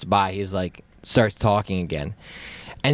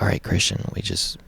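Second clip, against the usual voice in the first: low hushed voice, nearly whispered; acting out a discreet word to a friend; hushed plea; 3 semitones lower, 6 dB quieter, faster than usual. Hushing friend in quiet car.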